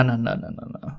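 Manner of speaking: ordinary talk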